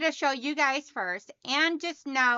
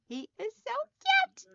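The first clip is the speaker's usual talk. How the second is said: much higher, gushing over the candle; cutesy gush; 12 semitones higher than usual. Real, not acted. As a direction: coo over something adorable. Gushing over her new candle.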